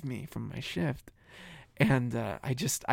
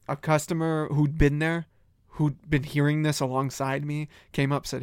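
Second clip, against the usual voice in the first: ordinary delivery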